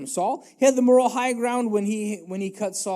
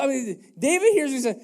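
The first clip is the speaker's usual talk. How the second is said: normal talking voice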